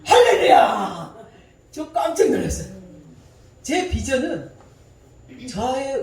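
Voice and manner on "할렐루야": slow, very loud hallelujah; preacher's rousing hallelujah, drawn out and loud; shout of praise. Real, not acted. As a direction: belt it out to the crowd. Joyful exclamation to audience.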